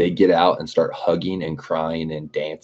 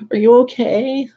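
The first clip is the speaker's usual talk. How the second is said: very high squeaky mock of the driver; mocking ditzy girl; 5 dB louder than usual. Mocking the driver who hit his car, squeaky high voice. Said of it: "literally how she was".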